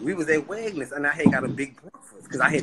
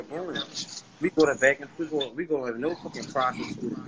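ordinary delivery

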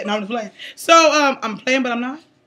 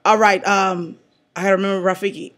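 ordinary talk

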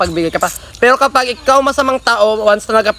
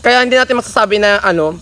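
speaking between small bites; chewing while talking. Real, not acted. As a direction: mouth partly full of fruit. Seen 0: Left speaker holds plum at mouth, nibbling and chewing while talking.

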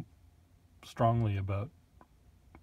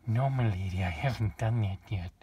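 louder, more hammed-up servant voice; 4 dB louder than usual. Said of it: "My repertoire is".

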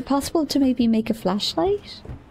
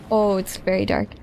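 usual speaking voice

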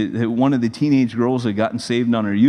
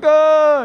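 loud, high "ah" of alarm; comic yelp of helplessness; mock panicked cry; 7 dB louder than usual. Young pastor overwhelmed by marriage call, mock panicked cry.

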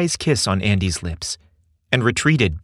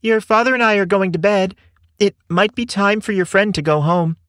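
high-pitched mom calling out; high, carrying motherly voice; pleasant but firm mother; 10 semitones higher, 4 dB louder than usual. A narrator's reading. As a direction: call down to your son, kindly but firm. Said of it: "A pleasant but firm voice called".

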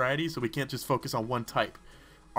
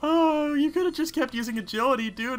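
high, loud startled cry; shocked outburst; 10 semitones higher, 4 dB louder than usual. Real, not acted. Gamer yelling at the screen in shock. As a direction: yelp at the sudden hit. His Pokemon suddenly gets hit.

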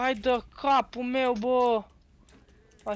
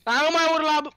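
raised voice, telling him off; loud scolding; 4 semitones higher, 5 dB louder than usual. Real, not acted. Telling his friend to stop yelling, scolding loudly.

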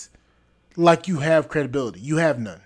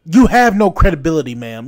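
louder, fast, scornful jab; angry outburst; 8 dB louder than usual. Real, not acted. Ranting at the actress about her credibility, angry and loud. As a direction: spit it out at her, fast and fed up.

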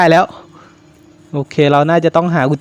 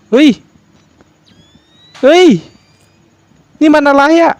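high, alarmed what is this; a sudden startled question; 10 semitones higher, 4 dB louder than usual. Real, not acted. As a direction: jump at the find and ask. Startled finding in horror game.

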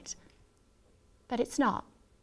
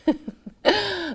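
bitter laugh; 9 semitones higher, 4 dB louder than usual. A narrator's reading.

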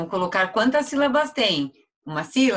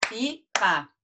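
"pipa" in two separate beats, pi, pa; syllable by syllable; 4 semitones higher than usual. Shown as a demonstration. Teacher claps out the word with pupils, syllable by syllable. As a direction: clap out "pipa", first syllable high. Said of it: "bater palmas?".

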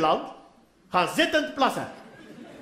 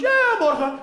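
dismissive, higher and louder each time; lazy husband; 7 semitones higher, 3 dB louder than usual. Retold or imitated. Husband stalling his wife, dismissive. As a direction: brush her off yet again.

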